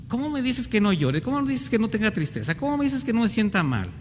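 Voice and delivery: high, pleading indignation, a bit rushed; high-pitched hurt protest against being told not to cry; indignant protest. Acted. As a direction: throw the words back in disbelief. Voicing the grieving listener's protest.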